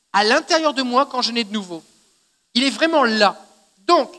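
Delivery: normal talking voice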